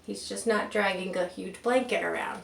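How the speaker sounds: plain reading voice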